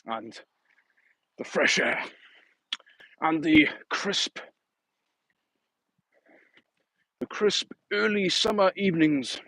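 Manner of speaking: lightly hammed-up nature documentary narrator; a hint of a hushed wildlife narrator savouring each word; David Attenborough. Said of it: "I sound like David Attenborough".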